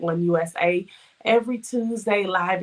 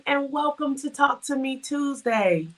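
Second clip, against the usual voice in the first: cheery greeting; 8 semitones higher, slower than usual. Real, not acted. Opening greeting of a live show.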